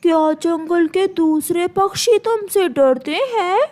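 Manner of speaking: sweet, coaxing bird voice; butter up the crow slyly; sly myna flattering. Acted. Myna coaxing the crow out of his bread, sweetly sly.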